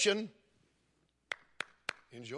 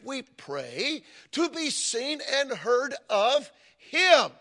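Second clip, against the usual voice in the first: higher and louder, slow, rising to a shout on him; preaching at full force; 7 semitones higher, 4 dB louder, slower than usual. Acted. Pastor driving home God's reward to the congregation, full-force preaching. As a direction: thunder it out from the pulpit.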